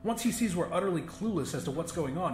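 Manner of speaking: ordinary delivery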